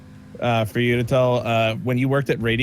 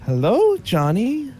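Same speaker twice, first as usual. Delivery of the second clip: higher, gentle sing-out greeting; gentle creepy old man; 8 semitones higher, slower than usual. Retold or imitated. Boss recording a child's alarm clock message, gentle creepy voice. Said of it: "gentle voice".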